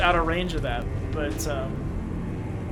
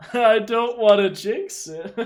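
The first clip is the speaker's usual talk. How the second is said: slow laughing jinx remark; 4 semitones higher, 4 dB louder, slower than usual. Real, not acted. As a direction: laugh through the words. Laughs while saying it again.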